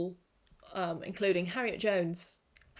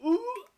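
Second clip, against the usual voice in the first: spooked ooh; 14 semitones higher, 6 dB louder than usual. Real, not acted. Shudder at the creepy scene.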